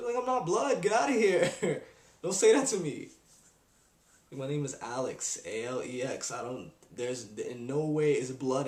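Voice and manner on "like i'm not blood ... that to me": louder, higher laughing protest; half-laughing get out of here; mock outrage. Telling a guy not to call him blood, louder laughing outrage.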